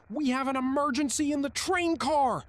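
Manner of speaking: very high, very loud emergency yell; full yell. A narrator's reading. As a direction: scream it into the intercom at full force. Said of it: "and yelled".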